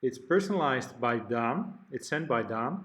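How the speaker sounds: ordinary delivery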